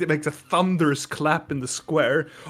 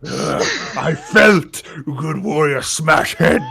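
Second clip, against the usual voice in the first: slow, louder broken speech; Minotaur brute voice; 5 dB louder, slower than usual. Acted. Minotaur boasting about the headbutt, slow brute voice. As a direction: grunt it out proudly.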